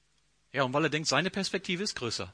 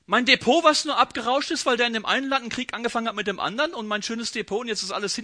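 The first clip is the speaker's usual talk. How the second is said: higher, louder, rushing grievance; agitated complaint; 4 semitones higher, 3 dB louder, faster than usual. Retold or imitated. Believer ranting to God about a crashed portfolio, agitated and fast. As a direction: tumble out the outrage.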